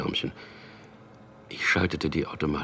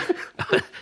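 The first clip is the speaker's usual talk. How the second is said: sly chuckle; 5 dB louder than usual. Acted. Chuckles over his invented conversation.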